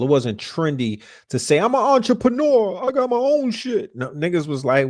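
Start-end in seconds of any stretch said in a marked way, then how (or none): 1.6-4.0 high, put-on voice mocking a boaster; a high, hammed-up brag, mocking the trend. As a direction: lampoon someone bragging they're an entrepreneur. Mocking trendy self-promoters.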